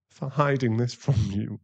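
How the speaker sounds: ordinary talk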